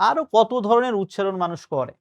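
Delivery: ordinary talk, nothing marked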